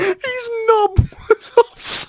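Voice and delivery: cracking up mid-sentence; wheezing laugh through the words. Real, not acted. Repeating it while laughing hard.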